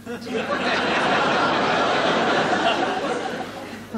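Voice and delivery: breathy fake sob; comic sobbing. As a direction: sob like a tiny frog. Frog cries on riverbank.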